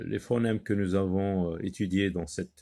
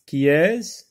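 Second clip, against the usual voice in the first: qui EST-CE with a sharp rising question tune; teacher modelling the question qui est-ce; questioning rise; 6 semitones higher, 7 dB louder than usual. Shown as a demonstration. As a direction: ask qui est-ce loud and high, like quizzing the class. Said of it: "On pose toujours la question qui est-ce".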